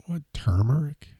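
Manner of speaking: breathy, high disbelieving whisper; incredulous whisper. Real, not acted. Disbelief at new spelling.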